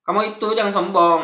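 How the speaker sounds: lightly put-on Madura accent, called out forcefully. Shown as a demonstration. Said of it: "dialeknya contohnya kayak gini". (logat Madura yang dibuat-buat, lantang)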